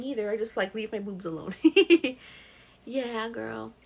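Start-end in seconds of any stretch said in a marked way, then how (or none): none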